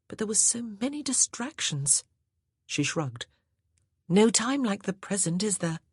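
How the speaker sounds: wife's voice. Acted. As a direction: sweetly suggest the wine. Wife character speaking.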